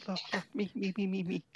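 high, soft, rapid-repeat pleading; volunteer eagerly in a small high voice; eager pick-me. Pretend. Begging to be picked for deal.

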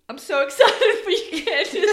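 sobbing laugh, high and loud; blubbering with happy tears; snotty crying. Real, not acted. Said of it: "she's really crying here".

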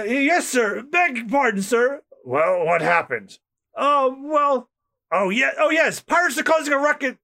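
lightly voiced jittery soldier; nervous underling. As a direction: stumble over the report to your boss. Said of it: "his underling".

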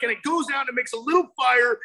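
ordinary delivery

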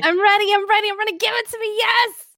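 high, loud eager yelps; can't wait, loud and high; excited. Real, not acted. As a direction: bounce with eagerness. Eager to hear the idea.

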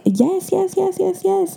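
high, eager string of cheers; imitating dad rooting. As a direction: cheer someone on in a high voice, without shouting. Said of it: "my dad would be right there rooting for me".

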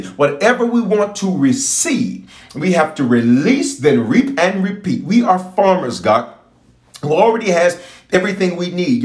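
ordinary talk, nothing marked

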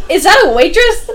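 high and loud disbelief; mock surprise. Detective catching the lie on the phone, loud mock surprise. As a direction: pounce on the slip-up with mock disbelief. Detective hears waitress on call.

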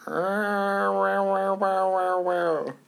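high, sustained cat yowling; intimidating cat yowl. Shown as a demonstration. Host scaring off anyone who comes at them, high cat yowl. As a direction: wail "wow" like a cat warning off a rival. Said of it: "That's my intimidation".

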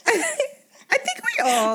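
laughing. Real, not acted. Laughing agreement.

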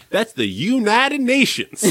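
put-on high-pitched boy slipping into a Southern drawl; slow, swooping mock Southern accent. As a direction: draw out "United Nations" like a Southerner. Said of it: "develops a Southern drawl for those two words".

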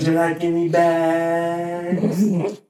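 high, hugely drawn-out mock question; fake cashier voice. Co-host parodies the cashier's bag question, high slow fake voice. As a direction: stretch it out high, parodying customer service.

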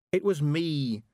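put-on a distressed girl's confession; wailing. A narrator's reading. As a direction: wail it, without full sobbing. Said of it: "wailed Tiffany".

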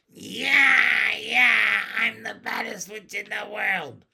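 put-on high, loud child witch yell; screaming taunt. A narrator's reading. Child witch taunting the girl with curls, screaming. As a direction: crow it at full voice.

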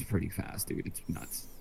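breathy whisper, no voice. Real, not acted. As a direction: whisper it in awe. Marveling at board speed.

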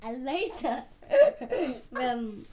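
badly faked English accent, trailing down; attempted English accent. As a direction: put on an unconvincing English accent. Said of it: "That is not an English accent".

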